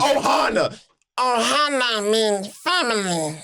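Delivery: slow, strangled cartoon alien voice; Stitch. Friend asked for a Lilo and Stitch impression, cartoon alien voice. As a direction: put on a slow cartoon creature voice. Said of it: "a Stitch impression from Lilo and Stitch".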